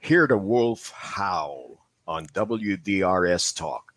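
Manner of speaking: radio announcer plug. Shown as a demonstration. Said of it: "let's try this one".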